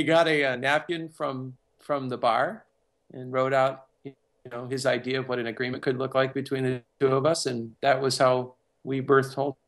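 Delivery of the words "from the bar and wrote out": usual speaking voice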